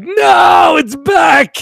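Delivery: high, loud mock wail; hammed-up howl of despair; dismayed shout. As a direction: cry out in exaggerated disbelief. Acted dismay at returned toy.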